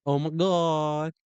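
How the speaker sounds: put-on stretched, slow Valley Girl exclamation; mock Valley Girl accent. User playing along with the assistant, mock Valley Girl accent. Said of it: "in a Valley Girl accent".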